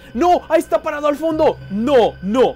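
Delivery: loud, high scared yell; panicked shout. Real, not acted. Gamer yells in panic as animatronic appears at door. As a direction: shout in real fright.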